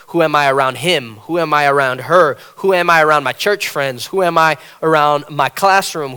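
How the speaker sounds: loud, rising sermon delivery; a swelling pulpit voice; preaching. Acted. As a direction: preach it out to the congregation. Preaching at full force.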